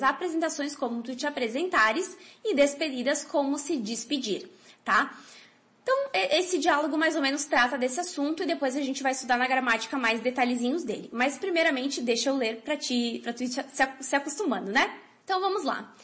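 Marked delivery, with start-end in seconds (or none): none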